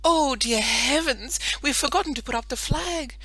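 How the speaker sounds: a mother suddenly remembering the flag; fussing mother. Acted.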